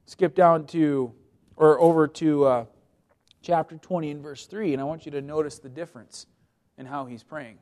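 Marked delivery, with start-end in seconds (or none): none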